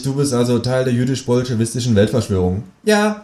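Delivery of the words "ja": high-pitched kangaroo figure. Acted. Kangaroo greets its human flatmate out of nowhere, high creature voice. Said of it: "sagt das Känguru".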